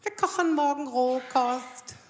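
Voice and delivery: slow, drawn-out, self-satisfied announcement; a prim health-food girlfriend announcing raw food; Sven's girlfriend proudly announcing a raw food dinner, slow. Retold or imitated. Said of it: "Freundin von Sven sagte".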